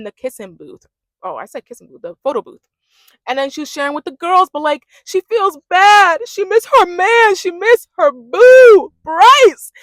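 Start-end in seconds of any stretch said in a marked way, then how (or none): 4.5-9.7 high, loud and slow swooning; excited gush. Real, not acted. Gushing that Trinity misses her boo Bryce, high and loud. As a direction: stretch it out like a swoon.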